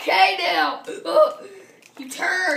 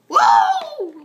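startled whoa; 11 semitones higher, 8 dB louder than usual. Acted. Falling off a dive.

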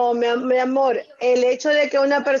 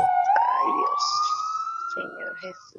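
much higher, fading to softer groaning appeal to God; exasperated plea; 6 dB quieter, much slower than usual. Real, not acted. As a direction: sigh it up to heaven, fading away. Woman exasperated by prank call.

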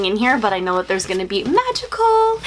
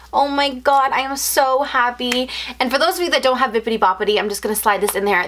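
normal talking voice

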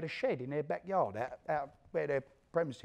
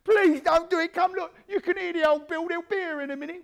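begging; 14 semitones higher, 10 dB louder than usual. Retold or imitated. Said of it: "Now he wants to beg for his life".

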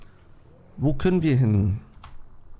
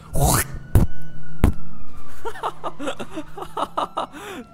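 loud laugh; 4 dB louder than usual. Real, not acted. Laughs after spitting fight.

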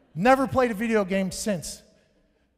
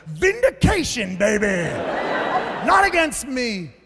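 higher, louder, slow triumphant shout; gloating cheer; 4 semitones higher, 3 dB louder than usual. Retold or imitated. Comic recalling his thoughts on the sideline, loud gloating. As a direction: crow it like a victory. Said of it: "all I can think about was".

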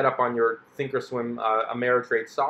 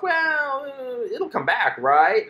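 much higher, slower and strained, ending on a hopeful question; cling to hope as the loss grows; desperate denial; 11 semitones higher, much slower than usual. Retold or imitated. Investor pleading that the crashing stock will come back, high and strained. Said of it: "and you go".

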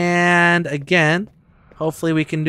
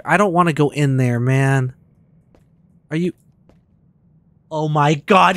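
ordinary talk

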